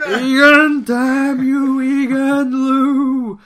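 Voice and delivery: loud, high, dragged-out villain curse; sputtering foiled villain. Acted. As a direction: shake your fist at the sky, howling. Shaking fist, cursing rival.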